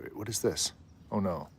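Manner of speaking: usual speaking voice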